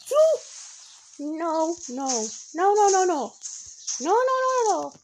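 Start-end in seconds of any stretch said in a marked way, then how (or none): none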